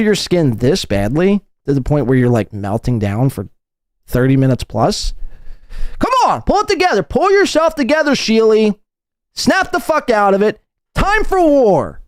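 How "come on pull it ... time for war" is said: higher, urgent pep talk; rally him to fight; fired-up pep talk. Acted. Pushing Mersh to go to war, fired-up.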